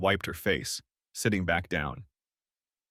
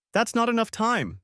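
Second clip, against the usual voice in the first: crying out; 14 semitones higher than usual. A narrator's reading. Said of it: "she cried".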